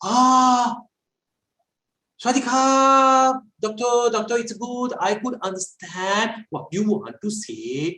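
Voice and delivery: high, slow imitation of a Thai student's English. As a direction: mimic the student's halting accented English. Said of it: "क्या Ohm's law".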